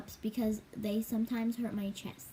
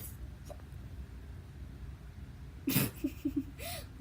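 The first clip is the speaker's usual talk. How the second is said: airy squeak of a laugh; high breathy giggle; 12 semitones higher, 7 dB quieter than usual. Real, not acted. Laughing at own hiccups.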